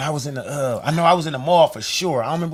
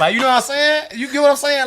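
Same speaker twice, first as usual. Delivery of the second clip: laughing; 9 semitones higher, 5 dB louder than usual. Real, not acted. Laughing while telling.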